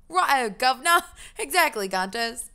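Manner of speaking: mock British accent. Streamer mocking British kids, hammed-up British accent. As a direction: mimic a British street kid's accent.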